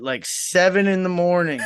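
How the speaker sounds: ordinary talk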